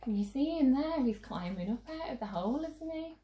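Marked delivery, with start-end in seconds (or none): none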